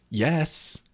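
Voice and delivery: acting out an enthusiastic friend; mock cheering friend. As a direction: playfully hype up a friend. Friend eggs him on, retold.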